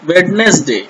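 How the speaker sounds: वेडनेसडे read as written, d sounded; as spelled. Shown as a demonstration. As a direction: sound out every written letter in वेडनेसडे: wed-nes-day. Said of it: "ये लिखा हुआ है". (वेडनेसडे जैसा लिखा है, 'डी' बोलकर)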